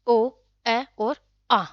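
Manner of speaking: ओ, ए और आ drawn out one by one; each vowel ओ, ए, आ held long; slow vowel demo. Shown as a demonstration. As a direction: sound out ओ, ए और आ slowly for the class. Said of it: "कौन सा?".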